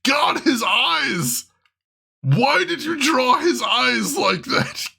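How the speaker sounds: high, drawn-out cry of dismay; aghast exclamation. Real, not acted. Streamer reacting to how his eyes were drawn, aghast. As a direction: wail it slowly in amused horror.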